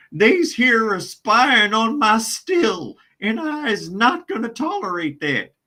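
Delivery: high, loud hammed-up backwoods drawl; comic Southern hillbilly accent; exaggerated hillbilly accent. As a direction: play the moonshiner guarding his still. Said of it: "clearly exaggerated for the purpose of humor".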